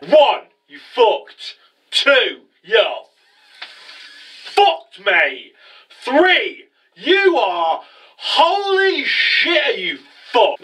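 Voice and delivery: drawn-out, high-pitched gloating countdown; mock villain countdown. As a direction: stretch each count into an over-the-top taunt. Retelling villain's three-point taunting countdown.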